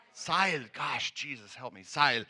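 a comic flash of fear, played big; mock panic. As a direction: joke figure yelling for the rope and for Jesus. Woman clinging to the rope panics.

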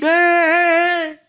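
laughing mock shout. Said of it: "Jesus hat nicht geschrien".

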